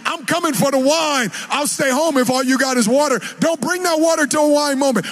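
rising, fervent preaching. Acted. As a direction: build it up for the church. Preaching at full force.

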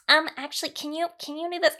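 high, squeaky mock-timid request; chipmunk voice. Woman imitating herself instructing her team, squeaky chipmunk. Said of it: "I sound like a fucking chipmunk".

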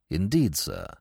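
measured, deep-toned manservant; Jeeves voice. Acted. Jeeves answering his young master's knock, low and composed.

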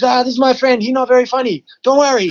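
high-pitched imitation of a beaming friend; excitable foreigner's high voice, played for laughs; cheerful friend. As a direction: reassure in a put-on high, cheery voice. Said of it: "comes running out with a big smile".

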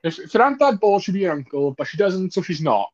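put-on high, playful Scouse accent; Liverpool accent. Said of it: "Give me your best Liverpool accent right now".

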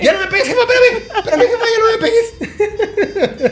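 very high mock pleading, laughing; hammed-up whining kid; begging child. Son begging mom to stop hitting, very high and whiny.

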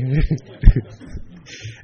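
short laugh at his own remark; nervous laugh. Real, not acted. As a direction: laugh off the nerves.

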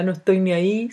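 lightly mimicked dopey voice; a hint of a dim-witted slacker, mildly imitated; Chino Río. Speaker quoting a nineties figure to listeners, lightly put-on dopey voice. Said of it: "con esa voz como de tonto".

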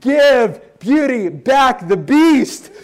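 slow, loud, laughing mock complaint; mock dismay. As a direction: drag it out, laughing at the ugly prince. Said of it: "and I'm like".

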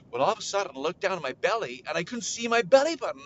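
ordinary delivery, nothing marked

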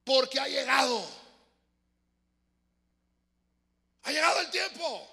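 grito agudo y lento del ángel; voz potente. Acted. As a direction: clama al ángel con toda la fuerza. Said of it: "con voz potente". (ángel gritando con voz potente)

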